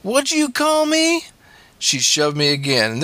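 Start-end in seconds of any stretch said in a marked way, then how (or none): 0.0-1.3 very high, drawn-out screech; outraged shriek. A narrator's reading. Stepmother to stepson who insulted her, shrieking. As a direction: shriek the question slowly, in outrage.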